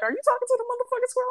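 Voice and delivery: high, rapid voice for a dog; mock disbelief, racing and squeaky; incredulous dog. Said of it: "Ruby looking at me like".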